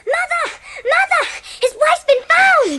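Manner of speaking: high, carrying call of joyful news; excited calling out. Acted. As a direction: shout the good news to mother across the house. Girl runs calling to her mother.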